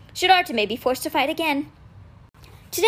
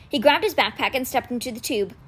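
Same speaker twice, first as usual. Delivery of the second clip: usual speaking voice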